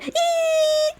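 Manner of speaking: a very high, excited squeal. Real, not acted. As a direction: let out a giddy shriek. Squeal before next character.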